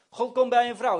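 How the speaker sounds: ordinary delivery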